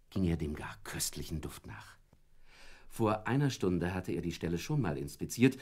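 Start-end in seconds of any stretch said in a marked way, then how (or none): 0.1-2.0 whispered, much softer and lower; whispering as the dog catches a scent; hushed whisper. A narrator's reading. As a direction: breathe it like a secret. Dog follows the smell.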